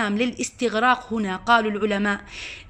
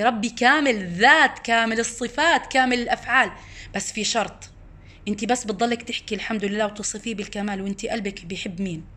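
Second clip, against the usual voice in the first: ordinary talk, nothing marked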